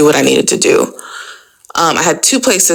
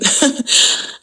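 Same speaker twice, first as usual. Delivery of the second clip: laugh; 9 semitones higher, 4 dB quieter than usual. Real, not acted. Laughing at the situation.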